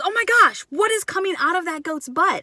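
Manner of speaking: high-pitched retold exclamation of alarm; Bob's shocked outcry. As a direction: imitate a startled boy blurting it out.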